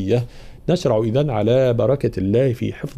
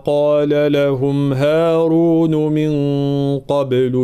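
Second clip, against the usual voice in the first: slow, chanted isti'adha; Quran recitation; 3 semitones higher, much slower than usual. Shown as a demonstration. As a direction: recite slowly for the class to repeat. (تلاوة بطيئة للتلقين)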